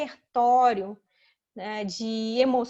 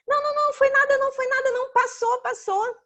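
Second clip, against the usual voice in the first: high, louder rapid-fire soothing; parent hushing child; 12 semitones higher, 6 dB louder, faster than usual. Acted. Parent to fallen child, high hurried dismissal. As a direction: brush off the hurt in a high parent voice. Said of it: "a primeira coisa que a mãe ou o pai faz".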